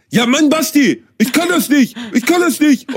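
hammed-up exasperated partner; imitating partner's high whine; whiny complaint. Mimics partner in retold quarrel.

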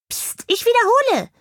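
very high, squeaky reprimand; admonishing hamster. A narrator's reading. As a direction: shush the bird like a tiny commander. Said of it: "ermahnte ihn der Hamster".

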